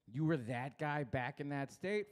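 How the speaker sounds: ordinary talk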